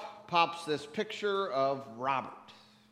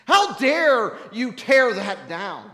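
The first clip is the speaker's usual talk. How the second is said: angry sad; 5 semitones higher, 6 dB louder than usual. Retold or imitated. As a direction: scold the new owners like they wrecked family history. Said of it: "And now I was angry sad".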